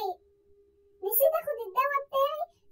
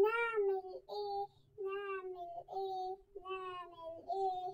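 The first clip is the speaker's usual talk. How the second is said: despairing; 4 semitones lower, 10 dB quieter, much slower than usual. Acted. Despairing repetition.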